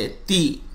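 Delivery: ती short, soft and even; correct tee. Shown as a demonstration. Teacher models the right ती quietly. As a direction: give ती softly, no jerk. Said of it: "इसको हम ती पढ़ेंगे".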